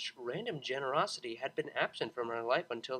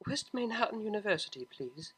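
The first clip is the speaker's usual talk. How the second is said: quiet sniffle; 7 semitones higher, 3 dB quieter than usual. Acted. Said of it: "she sniffled quietly".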